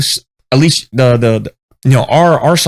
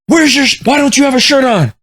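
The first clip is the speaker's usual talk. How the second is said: drill sergeant voice; 10 semitones higher than usual. Retold or imitated. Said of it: "and he like".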